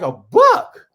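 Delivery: very high, loud squeak of surprise; stunned disbelief. Retold or imitated. Guest reacting to news of a book. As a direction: shriek it like you can't believe it. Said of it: "I'm like".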